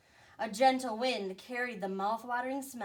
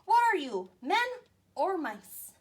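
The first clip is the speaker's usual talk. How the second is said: scolding commander; 8 semitones higher, 7 dB louder than usual. Acted.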